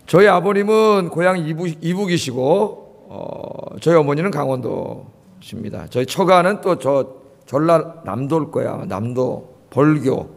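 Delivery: ordinary talk, nothing marked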